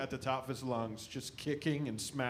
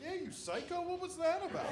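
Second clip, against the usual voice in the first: incredulous. Retold or imitated. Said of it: "I'm like".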